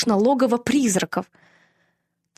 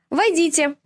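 calling out; 8 semitones higher than usual. A narrator's reading. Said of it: "отозвалась моя лучшая подруга".

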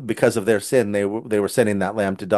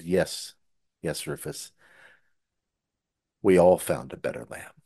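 tender father; 6 semitones lower, 7 dB quieter than usual. Retold or imitated. Father quietly answering his son, soft and lower. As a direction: answer the boy softly, full of meaning. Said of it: "And he said".

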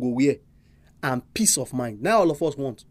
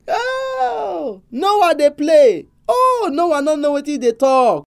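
much higher, slow, jeering voice for Noah's mockers; mocking crowd; 7 semitones higher, 3 dB louder, much slower than usual. Preacher voicing scoffers laughing at Noah, high mocking drawl. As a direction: sneer at Noah, drawing it out.

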